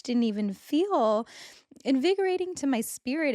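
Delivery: welling up, pitch lifting high; getting emotional. Real, not acted. Speaker recalling her own touch, getting emotional. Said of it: "it makes me emotional".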